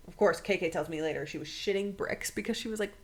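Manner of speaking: normal talking voice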